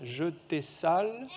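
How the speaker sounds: slow, separated "Je t'ai sale", with "tai" for "te"; angel's little accent. Acted. Preacher repeats the rebus for children, angel's little accent. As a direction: draw out "Je t'ai sale", rising at the end. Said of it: "il a un petit accent, l'ange".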